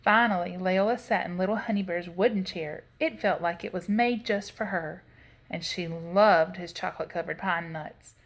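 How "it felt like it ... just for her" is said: plain reading voice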